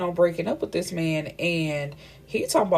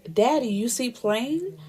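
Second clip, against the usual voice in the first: put-on higher toddler voice, rising at the end; cute little child; 5 semitones higher than usual. Little Rain turning to Damon, cute child voice. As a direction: imitate a small child's eager question. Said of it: "little cute self".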